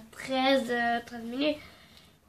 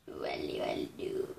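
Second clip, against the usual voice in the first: whispered walou; 14 dB quieter, much slower than usual. Pretend. Playful nothing.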